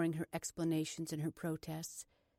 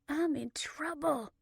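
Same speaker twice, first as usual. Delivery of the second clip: slow, higher, muffled confession; 8 semitones higher, 3 dB louder, slower than usual. A narrator's reading. Blair phoning her sister in secret, muffled. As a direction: say it as if avoiding being overheard. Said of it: "Blair's words were muffled".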